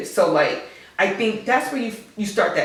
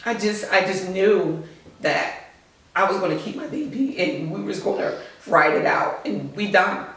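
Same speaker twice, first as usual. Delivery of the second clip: ordinary talk, nothing marked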